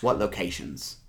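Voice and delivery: ordinary delivery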